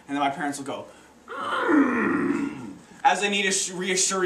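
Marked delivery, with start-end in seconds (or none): 1.3-2.7 long, high intrigued "mm" falling away; hammed-up parental grunt of interest. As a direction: hum as if intrigued, starting high. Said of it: "what I like to call the interested grunt".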